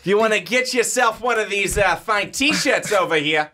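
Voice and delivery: hammed-up Boston accent salesman. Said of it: "you almost went into your Boston accent".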